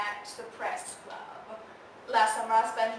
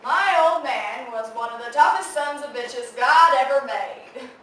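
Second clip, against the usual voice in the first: booming proclamation; 2 semitones higher, 10 dB louder than usual. Acted. Writer trying out a line about her father, booming proclamation. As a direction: belt out the opening line grandly.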